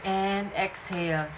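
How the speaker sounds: slow, calm breathing cues; guided breathing. Acted. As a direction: lead the breath gently. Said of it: "please inhale with me".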